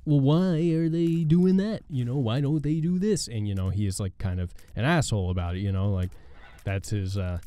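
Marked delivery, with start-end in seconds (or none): 0.0-3.3 Jerry Seinfeld. Acted. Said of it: "and then he's like".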